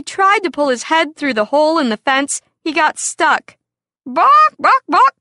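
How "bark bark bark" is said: high loud imitated dog barks; fierce barking. Dog attacks stuck rooster.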